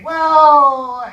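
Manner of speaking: loud, high, long drawn-out WELL; stalling filler. Retold or imitated. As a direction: stretch WELL out loudly to buy time. Imitates stalling before improvised answer.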